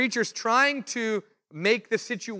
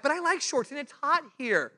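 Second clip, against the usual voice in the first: pitched way up, whining about the shorts rule; mock whiny protest; 8 semitones higher than usual. Told not to wear shorts in Guatemala, whiny protest. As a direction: complain like a kid denied his shorts. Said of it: "I'm like".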